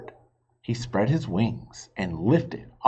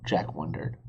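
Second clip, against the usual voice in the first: usual speaking voice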